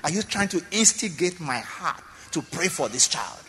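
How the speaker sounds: ordinary delivery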